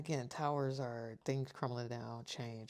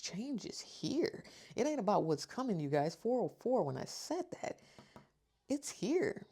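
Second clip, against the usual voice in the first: excited insistence; 7 semitones higher, 4 dB louder than usual. Real, not acted. Tarot reader urging viewers that change has arrived, excitedly. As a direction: build up the thrill that it's arrived.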